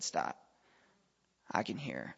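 a soft, low-key "stop, I can hear"; quiet girl's voice. Retold or imitated. Girl stopping the preacher mid-prayer, said softly. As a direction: hush it, like a shy girl cutting in.

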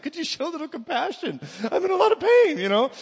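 high, loud, put-on whiny voice; mock whine. Husband whining to his wife Heather for sympathy, high mock whine. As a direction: ham up a self-pitying husband.

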